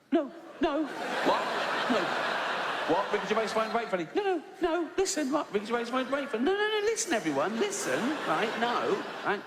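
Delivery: high-pitched flustered protest to the tweeter; flustered denial. Acted. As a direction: scramble to deny in a panic. Panicked denial in retold argument.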